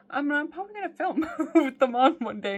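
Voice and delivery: laughing while talking. Real, not acted. To the viewers about wearing onesies on camera, laughing. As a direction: giggle through the confession.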